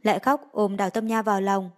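ordinary delivery

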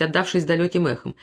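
ordinary delivery

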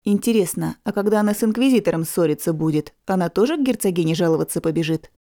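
plain reading voice

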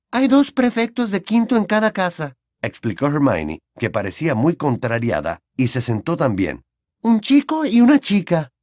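lightly voiced irritated girl; annoyed girl voice. A narrator's reading. Hermione explains crossly.

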